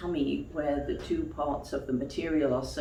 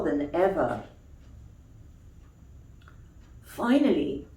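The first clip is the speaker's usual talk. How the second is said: put-on gentle storybook reading for children; story narration; 3 semitones higher, 6 dB louder than usual. A narrator's reading. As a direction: tell it like a bedtime tale. Reads a bedtime story.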